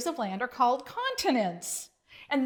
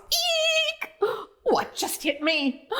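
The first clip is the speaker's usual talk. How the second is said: very high startled squeal; shriek; slower than usual. A narrator's reading. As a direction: shriek as something hits you. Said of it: "the vine shrieked".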